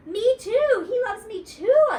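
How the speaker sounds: high, loud squeal; shrill gleeful squeak; real squealy. A narrator's reading. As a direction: squeal it like a thrilled little girl. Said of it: "she said real squealy".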